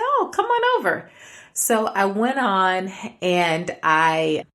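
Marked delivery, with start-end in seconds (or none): 0.0-1.1 much higher, sing-out retold welcome; welcoming invitation. Neighbor invites her across the alley, high welcoming call. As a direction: pitch it way up, inviting.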